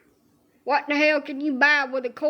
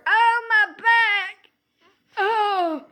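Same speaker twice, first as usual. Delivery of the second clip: high, loud, slow pained howl; drawn-out yelp of back pain; holler in pain; 8 semitones higher, 4 dB louder, much slower than usual. Retold or imitated. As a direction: cry out like your back just went. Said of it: "And then I holler".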